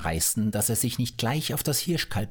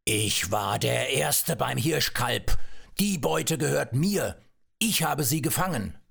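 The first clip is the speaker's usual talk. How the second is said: angry bear; 5 semitones higher than usual. A narrator's reading. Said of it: "ihn wütend an".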